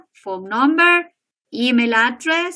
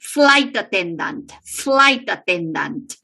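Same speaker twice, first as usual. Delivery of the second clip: Flight punched, attendant falling away; stress on first word; 4 semitones lower than usual. Shown as a demonstration. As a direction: lean on "Flight", let "attendant" drop lower. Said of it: "El estrés va en la primera".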